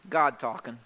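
low, understated payoff; weighty answer. Real, not acted. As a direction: drop low on "God talking".

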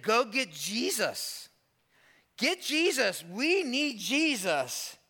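high, slow, pleading cry for help; begging them to fetch Jesus now; desperate plea. Retold or imitated. Sister begging for Jesus as brother dies, high slow plea.

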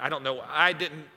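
ordinary talk

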